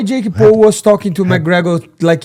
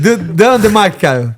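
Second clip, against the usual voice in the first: ordinary talk, nothing marked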